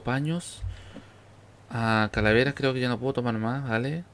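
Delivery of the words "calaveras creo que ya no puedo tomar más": normal talking voice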